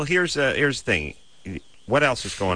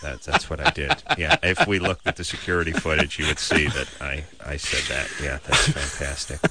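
low, muttered sarcastic patron; lowered voice, resentful customer bit; passive aggressive; 6 semitones lower than usual. Acted. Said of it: "passive aggressive, uh, Carvel patron".

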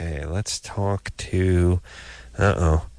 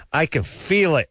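higher, lightly mocking caller voice; mimicking the caller; 7 semitones higher than usual. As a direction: parrot her vague answer.

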